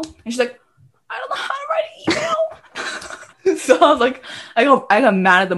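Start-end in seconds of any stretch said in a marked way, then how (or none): 1.1-2.3 very high mock wail; hammed-up sobbing complaint; imitating crying sister. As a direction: whine it helplessly through pretend tears.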